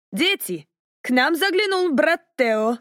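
high, announcing Irish mother; Irish woman calling the children; Irish accent. A narrator's reading. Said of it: "громогласно заявила".

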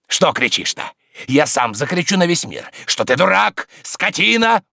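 high, loud abuse climbing to the last insult; angry yelling. Acted. Tarantyev threatens to tell the world, angry yelling. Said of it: "кричал Тарантьев".

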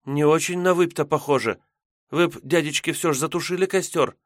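put-on high, pleading young girl; uneasy girl voice. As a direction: beg them like a nervous girl. Maiden urges putting out fire.

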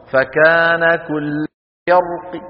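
chanted فكان كل, then فرق with a faint final kasra; rawm stop. Shown as a demonstration. Teacher repeats it for listeners, rawm stop. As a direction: recite slowly, end فرق on a reduced "i". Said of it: "هكذا الروم، اسمعوا".

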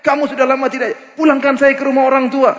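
shrill it out like a fed-up wife scolding; nagging angry wife. Retold or imitated. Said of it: "Istri mungkin bilang". (istri marah menuntut cerai)

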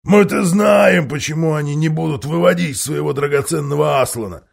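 loud scornful dwarf; jeering dwarf. Acted. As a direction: gloat to the crowd, loud. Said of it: "выкрикнул он".

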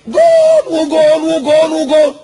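long, high commentator's goal shout; screamed goal call. Acted. Mimicking a commentator celebrating a goal, screaming slowly and high. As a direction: yell it drawn out, high and loud. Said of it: "ويزعق ويصرخ".

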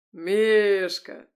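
loud, drawn-out hearty bellow; roared in bass. A narrator's reading. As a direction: bellow the nickname like a giant guest. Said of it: "заревел гость басом".

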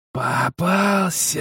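slow, far-off monster growl; inhuman growl. A narrator's reading. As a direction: drag it out like a beast. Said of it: "прорычала она нечеловеческим голосом".

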